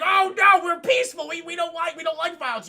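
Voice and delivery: high, loud mock alarm; hammed-up frantic protest; mock panicked plea. Leia pleading for her peaceful planet, mock panic.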